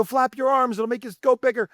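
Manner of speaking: high, upbeat dad's pep talk; father coaching his skater daughter in the car; playful coaching. Retold or imitated. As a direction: urge her on playfully. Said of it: "I go".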